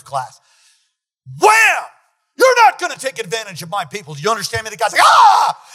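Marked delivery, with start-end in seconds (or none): 1.4-1.8 loud high "wham" for a fist blow; glass pound. Retold or imitated. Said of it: "pounds the glass like a man". 2.3-4.7 louder, commanding threat; forceful shout. Retold or imitated. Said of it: "pounds the glass like a man". 5.0-5.6 high loud cry of fright; startled yelp. Retold or imitated. Startled guy after the pound.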